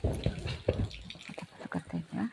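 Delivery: slow whisper to the viewers; whispered aside. Real, not acted. Owner whispers to viewers while watching pet, slow whisper. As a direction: hush so the pet isn't disturbed, slowly.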